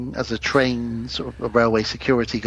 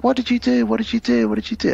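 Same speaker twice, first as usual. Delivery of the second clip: high, rising alarm, repeated; frantic questioning, pitched up; panicked repeat; 10 semitones higher than usual. Retold or imitated. Girlfriend panics at her husband, high and alarmed. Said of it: "And she's like".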